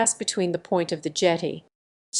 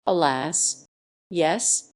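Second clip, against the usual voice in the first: plain reading voice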